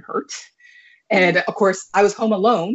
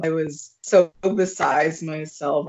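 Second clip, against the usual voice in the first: ordinary delivery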